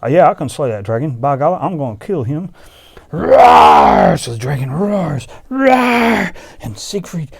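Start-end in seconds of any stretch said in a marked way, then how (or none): 0.1-2.5 fast, loud brag; loud eager hero; loud boast. Acted. 3.1-4.2 huge low roar; low loud beast roar; loud dragon roar. Acted. Dragon roars. 5.5-6.3 loud high dragon roar; loud pitched-up roar. Acted. Dragon roars again.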